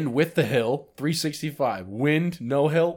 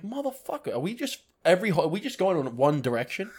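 high-pitched exasperated complaint at the wind; mock outrage, pitch jumping up; exasperated golfer griping at the headwind; frustrated cursing; 7 semitones higher than usual.

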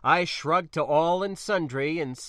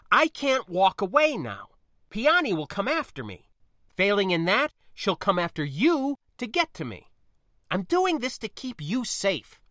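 higher, emphatic justification; urgent insistence; 8 semitones higher, 5 dB louder than usual. Acted. Spacer explaining danger to protect niece, urgent. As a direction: plead the case forcefully.

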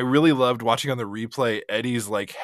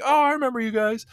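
high-pitched acted recognition; retold delighted greeting; 12 semitones higher, 4 dB louder, faster than usual. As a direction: greet familiar fans in a raised voice. Acts out celebrity greeting returning fans.

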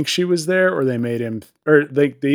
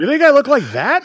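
offended chicken; 12 semitones higher, 5 dB louder than usual. Retold or imitated.

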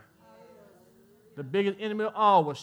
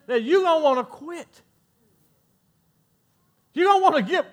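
higher and louder, rising to a preacher's cry; full-force preaching; 8 semitones higher, 8 dB louder than usual. Acted. Preacher warns the congregation, rising to full-force preaching. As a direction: press each warning harder.

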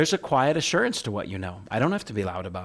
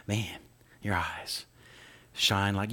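soft, lower, tender sweet talk; smitten lover; 3 semitones lower, 7 dB quieter, much slower than usual. Retold or imitated. Young man smitten with his date, soft and tender. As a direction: murmur it like a besotted new boyfriend. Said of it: "I wanted nothing more than just talk".

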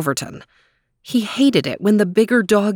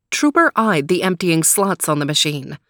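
ordinary delivery, nothing marked

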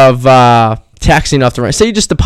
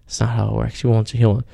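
ordinary talk, nothing marked

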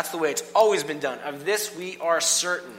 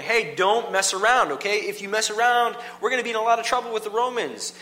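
raised, urgent warning from a teacher; a Pharisee warning the crowd, louder and higher; teachers' warning; 8 semitones higher, 5 dB louder than usual. Retold or imitated. Pharisee teacher warns the crowd, raised and urgent. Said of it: "there's teachers going".

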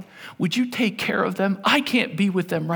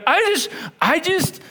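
desperate prayer; 7 semitones higher than usual. Retold or imitated. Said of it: "and I'm praying, and I go".

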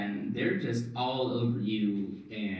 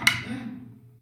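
sharp high gasp; loud acted gasp of panic; panicked flailing; 7 dB louder than usual. Game master acts out the player swiping at bugs, panicked gasp. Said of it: "you start swiping and flicking and being like".

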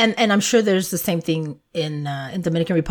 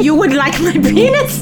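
high, loud mock-shocked repeat; raised-voice, scandalized mimicry; incredulous echo; 7 semitones higher, 6 dB louder than usual. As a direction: echo it back in amused disbelief. Repeats clip line in disbelief.

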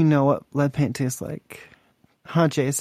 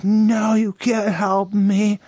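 mildly raised, drawn-out angry retort; mock angry; 3 semitones higher, much slower than usual. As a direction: imitate a mad woman refusing help, slowing at the end. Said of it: "and she got mad".

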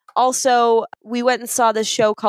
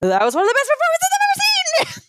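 much higher, overexcited fangirl outburst; gushing fan scream; faster than usual. Retold or imitated. Telling the actress she was amazing, high excited gush. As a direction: blurt it out like a starstruck fan. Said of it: "I just screamed at her".

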